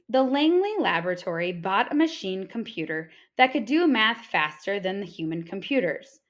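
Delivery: ordinary delivery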